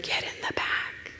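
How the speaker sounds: soft unvoiced whisper of an inner voice; a hushed voice in the spirit. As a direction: breathe it like a quiet inner prompt. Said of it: "the Holy Spirit said".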